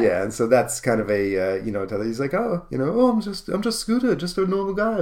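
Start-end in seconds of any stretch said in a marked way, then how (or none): 2.3-5.0 put-on high, aw-shucks teen idol voice; mock humble teen idol. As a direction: play it modest and sweet.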